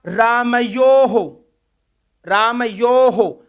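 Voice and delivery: रामयोहो drawn out slowly, the final हो echoing the o; teacher slowly sounding out the visarga; slow pronunciation demo. Shown as a demonstration. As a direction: spell out रामयोहो syllable by syllable. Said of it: "ध्वनि किसकी आ रही है".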